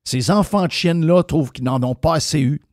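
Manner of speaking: usual speaking voice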